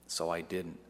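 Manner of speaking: plain reading voice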